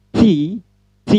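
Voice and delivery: T dengan ujung lidah di belakang gigi atas, ada letupan udara; contoh bunyi T. Shown as a demonstration. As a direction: ucapkan "T" dengan letupan angin. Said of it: "Gigi atas bagian belakang bertemu dengan ujung lidah". (ucapkan "T" dengan hembusan udara yang jelas)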